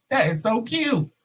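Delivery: high, teasing coo. Acted. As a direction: coo it like an amused queen. Coworker coos at his denial.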